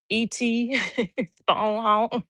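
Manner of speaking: ET impression. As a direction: mimic E.T. reaching for home. ET quote impression.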